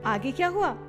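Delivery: usual speaking voice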